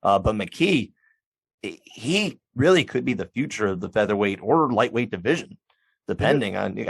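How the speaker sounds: usual speaking voice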